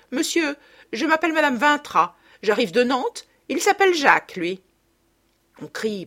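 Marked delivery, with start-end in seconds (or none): 0.1-4.6 high, loud proud announcement; proud mother. A narrator's reading. Mother grandly introducing herself to the patron. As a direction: present yourself grandly.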